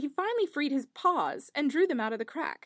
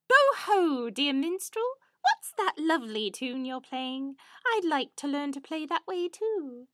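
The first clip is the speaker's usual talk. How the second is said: sly fox; 3 semitones higher than usual. Acted. Fox voiced in fairy tale.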